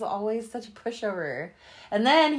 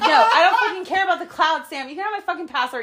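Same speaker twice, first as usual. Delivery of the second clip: louder, higher, sassy yell; loud exasperation; 7 semitones higher, 7 dB louder than usual. Retold or imitated. Arguing with Sam over his password, loud sass. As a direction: snap at him, fed up.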